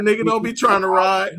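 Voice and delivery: loud laughing imitation; mock tough quote. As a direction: bark it out while laughing. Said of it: "until Ace said".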